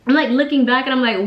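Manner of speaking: ordinary delivery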